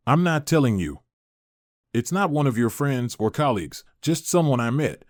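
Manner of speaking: plain reading voice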